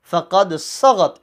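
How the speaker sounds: "صغت" with the first a short; measured "فقد صغت", no stretch on the sa; short vowel. Shown as a demonstration. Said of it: "اس کو short پڑھنا ہے".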